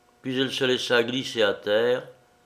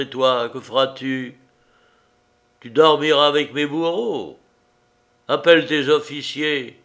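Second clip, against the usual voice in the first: defiant shout; 4 semitones higher, 6 dB louder than usual. Acted. The man hurling his defiance at Liuba, louder. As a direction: bark "Oui, honnête homme" proudly.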